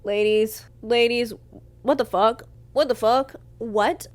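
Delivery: loud, incredulous appeal; outraged disbelief. Real, not acted. Outraged appeal to listeners over the quote.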